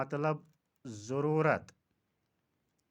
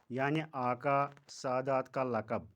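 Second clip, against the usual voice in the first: ordinary delivery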